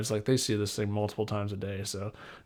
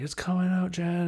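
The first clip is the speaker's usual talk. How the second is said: put-on higher, urgent call that the baby is coming; a nurse tells Jen the baby is coming, in a higher, urgent voice; excited nurse call; 8 semitones higher than usual. Nurse telling Jen the baby is coming, higher and urgent.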